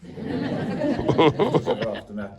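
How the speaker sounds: laughing through the words, slow. Real, not acted. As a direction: chuckle while correcting. Joking correction with laugh.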